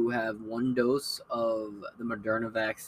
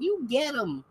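much higher, chirpy granny cheer; cheering old lady; 14 semitones higher, slower than usual. Retold or imitated. Lily watching Columbo while everyone is sick, high chirpy cheer. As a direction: cheer them on like a gleeful grandma.

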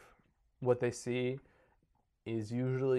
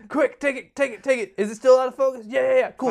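high, loud comic panic; frantic mock yelling; hammed-up urgent shouting; 12 semitones higher, 11 dB louder than usual. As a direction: shout as if the moment is slipping away. Acted hurried photo with Bigfoot.

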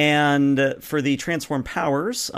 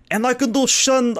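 higher, louder Connery attempt; Sean Connery voice; 11 semitones higher, 4 dB louder than usual. Shown as a demonstration. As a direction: start a Sean Connery impression. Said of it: "I'm trying to mix Connery with Slavic".

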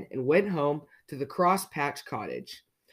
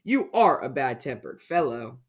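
ordinary delivery

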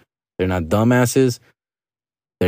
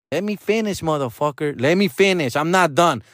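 high, softer mock-indignant demand; insistent mom; 6 semitones higher, 4 dB quieter than usual. Mom demanding to finish her rant, high imitated insistence. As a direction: mimic her refusing to be interrupted, pitched up. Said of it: "She goes".

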